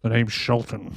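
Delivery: badly faked spy introducing himself; bad Sean Connery. Hotel guest acting sophisticated, bad Connery. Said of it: "he tries to do, uh, Sean Connery".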